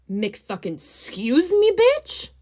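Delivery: slow scuse me, then bitch shot way up; indignant diva comeback; woman insulted on a date, outraged sass. Real, not acted.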